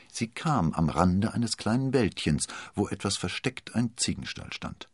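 ordinary delivery

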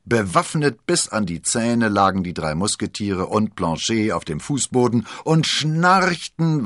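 ordinary delivery

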